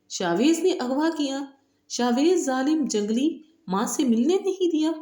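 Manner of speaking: can't believe what she hears; stunned disbelief. A narrator's reading. Grandmother echoing the girl's claims in shock, pitched high.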